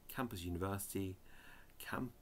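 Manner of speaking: quick whispered "Campus University". Real, not acted. Reading dictionary entries to viewers, quick whisper. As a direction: whisper the entry to yourself, a little quick.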